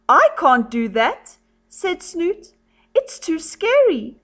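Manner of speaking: high, protesting little voice; high-pitched storybook fretting; scared story character. A narrator's reading. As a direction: lift the pitch in mock worry. Reads frightened character's protest.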